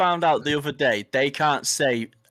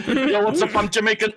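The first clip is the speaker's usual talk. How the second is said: higher, laughing fake-Jamaican greeting; badly faked Jamaican, breaking into laughs; bad Jamaican accent; 8 semitones higher, 4 dB louder than usual. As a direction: imitate a hopelessly wrong Jamaican accent. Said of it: "It's not even Jamaican. Nor the accent".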